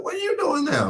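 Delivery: light, upbeat buddy voice; high, chummy question; friendly question. Retold or imitated. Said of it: "He said".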